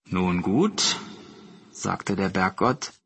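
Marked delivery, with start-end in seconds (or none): 0.0-1.0 lower, measured god consenting; lightly voiced solemn old god; mountain god. A narrator's reading. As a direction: grant it gravely, pitched down. Mountain god grants the request.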